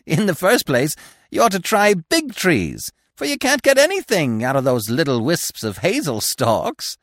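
high, animated woodpecker voice; eager woodpecker. A narrator's reading. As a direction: play a high-voiced bird giving lively advice. Woodpecker advising Bambi.

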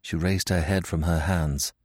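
plain reading voice

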